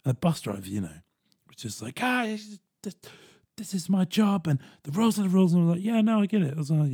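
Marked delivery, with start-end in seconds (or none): 2.0-5.5 high-pitched, put-upon protest; mildly exasperated insistence on procedure; defensive bus driver. Retold or imitated. Bus driver refusing to bend for a passenger, defensive. 5.8-6.5 higher, hurried-to-agree appeasing tone; smooth him over with ready agreement; placating. Retold or imitated. Go-between soothing an annoyed bus driver, placating.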